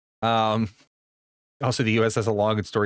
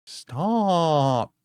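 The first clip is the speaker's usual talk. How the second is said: high drawn-out cutesy stop; 6 semitones higher than usual. Acted. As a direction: whine it like a pouting cutie. Protesting being called cute.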